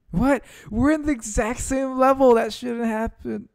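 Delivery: high, stunned what; shocked disbelief. Real, not acted. Gamer shocked at a bad loss, stunned and high.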